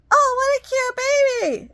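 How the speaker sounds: high, slow, syrupy mock of a cooing lady; gushing stranger lady. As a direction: coo it like an intrusive stranger. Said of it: "some lady come up to me".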